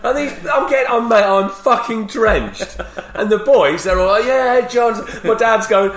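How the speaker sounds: high-pitched, loud comic outrage; shouted storytelling. Retold or imitated. Telling mates he got drenched by dad, shouting. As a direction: keep yelling the story, worked up.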